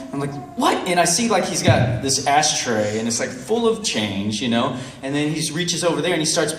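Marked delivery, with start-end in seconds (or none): 0.6-0.9 high-pitched incredulous question; acted disbelief. Storyteller hearing beggar's denial, exasperated disbelief.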